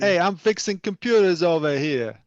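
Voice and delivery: a hammed-up Brooklyn shout-out; mock New York. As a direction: tease like a New York street guy. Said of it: "He's taunting me".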